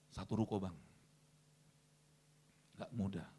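low, soft deflated reply; mock reluctance. Preacher voices congregation balking at huge sum, soft mock reluctance.

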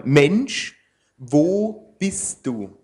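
slow, searching call of a father; God calling out. Acted. God calls after Adam, slow and longing. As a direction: draw out each word as if calling into emptiness. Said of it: "Und Gott, der Herr, rief Adam".

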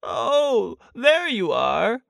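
high, slow, lightly voiced bear call. A narrator's reading. Pooh greets Christopher Robin returning, high mild call. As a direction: call down, stretching the words a little. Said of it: "called down Winnie the Pooh".

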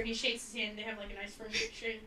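ordinary delivery

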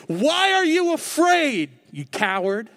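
mock an angry father calling his son a coward. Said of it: "an angry father pointing his finger saying".

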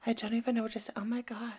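whispered, stunned imitation; mock whisper. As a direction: breathe the words in disbelief. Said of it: "She's like".

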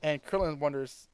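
ordinary talk

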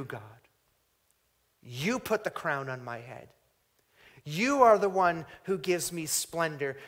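loud, rising preaching that builds on each line; preaching at full force. Acted. Preacher voicing David's thanks to God, building to a loud climax.

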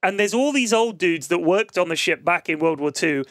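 ordinary talk

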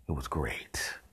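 whispered with a hint of glee; gleeful whisper. Delighting in the flies' fate, whispered. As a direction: hush it like a guilty pleasure.

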